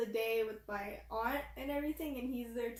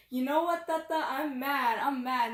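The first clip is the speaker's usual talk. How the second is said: louder, a child's put-on pout; mock mad kid; 3 semitones higher, 7 dB louder than usual. Girl tells grandpa she's mad about ice cream, mock pout. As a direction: play a sulking little kid.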